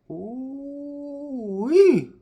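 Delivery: high drawn-out Southern Ooh-wee, falling; a big Southern Ooh-wee; delighted Southern exclamation. Real, not acted. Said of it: "the southern accent's starting to come out".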